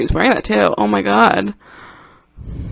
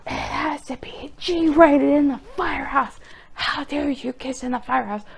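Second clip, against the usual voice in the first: higher, hushed, scandalised whisper; freaked-out Ray; 7 semitones higher than usual. Retold or imitated. Ray scolding the dream couple, higher scandalised whisper. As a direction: hiss a prudish scolding. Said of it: "Ray freaked out".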